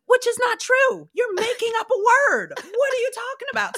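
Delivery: high-pitched, exasperated mock argument; incredulous protest. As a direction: call out the nonsense, voice way up. Mock arguing with mom's claim.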